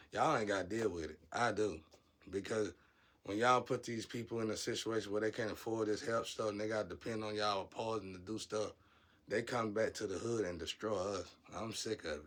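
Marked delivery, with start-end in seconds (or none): none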